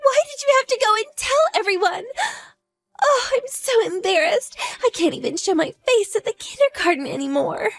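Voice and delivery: very high, tearful distressed cry; crying shame and despair; anguished wail. Acted. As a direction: break down in humiliation. Despairing, humiliated mother.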